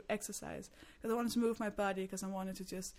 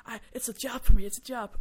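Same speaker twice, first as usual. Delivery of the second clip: mock angry therapist; 2 semitones higher, 14 dB louder than usual. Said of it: "she got really angry".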